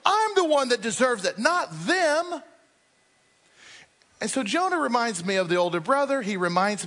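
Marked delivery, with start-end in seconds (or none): 0.0-2.4 self-righteous claim. Acted. Voicing Jonah's resentment.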